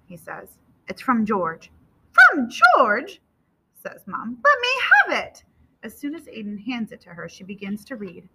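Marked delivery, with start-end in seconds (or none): 2.1-3.2 high, loud, eager echo; astonished question. A narrator's reading. Mom echoes the news, astonished. 4.4-5.4 very high, eager mother; loud, high and impatient; urgent demand. A narrator's reading. Mom demands son's letter.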